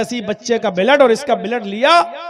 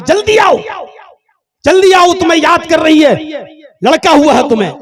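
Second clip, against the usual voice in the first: urgent call; 7 semitones higher, 8 dB louder than usual. Acted. Doctor urging on the phone.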